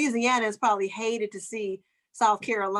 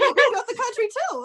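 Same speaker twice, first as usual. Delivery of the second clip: high mock proud; 13 semitones higher, 4 dB louder than usual. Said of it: "he's like".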